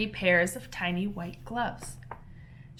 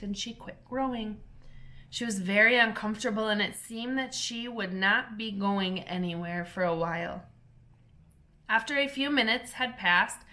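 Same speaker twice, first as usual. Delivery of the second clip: ordinary delivery, nothing marked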